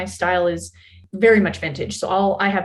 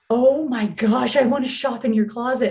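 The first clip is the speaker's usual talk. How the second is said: mock gushing; 3 semitones higher than usual. Makeup girl admiring an extra's clothes, gushing. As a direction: imitate an envious admirer gushing.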